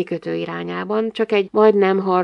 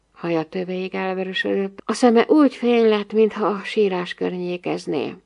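ordinary delivery

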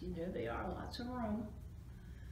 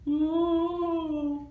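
high, loud, long ooh; ghostly ooh; 10 semitones higher, 10 dB louder than usual. A narrator's reading. Reader sounding the ghost for children, ghostly. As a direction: wail like a sheet ghost.

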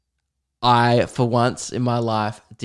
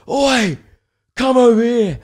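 high, loud Oi, come over here; rough shout; 11 semitones higher, 4 dB louder than usual. Retold or imitated. Calling a stranger over, a rough yelled Oi. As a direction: bark it across the street like a lout. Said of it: "It was".

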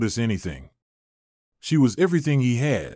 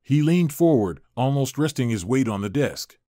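usual speaking voice

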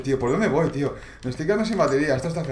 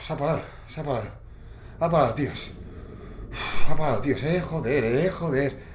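relieved hush; 5 semitones lower, 5 dB quieter than usual. Real, not acted. Danger passed, relieved.

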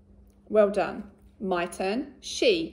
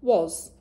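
was said loud and clear; modelled tricky word; 5 dB louder than usual. Shown as a demonstration. Teacher models was for the children, loud. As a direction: say was loudly for the class to copy. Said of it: "My turn".

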